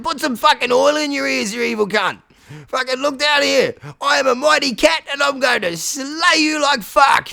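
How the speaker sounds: high, loud, slowed shout; furious battle cry; angry challenge. Acted. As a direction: scream up at the dragon to fight. Cat yells challenge at dragon.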